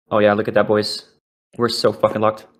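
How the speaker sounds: ordinary delivery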